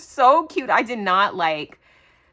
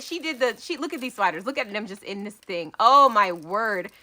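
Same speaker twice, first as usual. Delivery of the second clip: ordinary delivery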